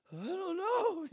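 very high, squeaky-pitched shrug; mock bafflement. Puzzled over doing or being, high mock bafflement. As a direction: throw the voice way up in confusion. Acts out confusion over wording.

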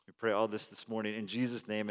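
quiet, earnest pastoral prayer voice. Acted. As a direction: pray it softly over the congregation.

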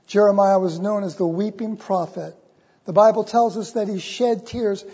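ordinary talk

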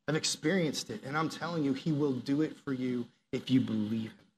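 ordinary talk

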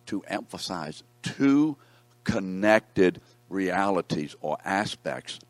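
slow down and hammer "two connected realities"; slow emphatic stress. Shown as a demonstration. Teacher slowly stresses the point.

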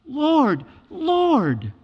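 high, loud anguished cry; pleading cry. Retold or imitated. False believers begging the Lord on the last day, high wail. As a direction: wail it up to heaven. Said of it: "with incredible affection".

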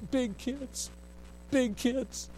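put-on high, small child's voice pointing blame; tearful little boy. Scolded boy pointing at the big kids, high tearful child voice. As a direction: whimper it like a scolded four-year-old. Weeping boy blaming the teenagers.

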